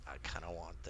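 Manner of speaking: very soft, low aside; hushed confession. Retold or imitated. Host tempted by a self-help book, hushed confession. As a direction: admit it under your breath.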